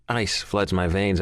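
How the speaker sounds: ordinary delivery, nothing marked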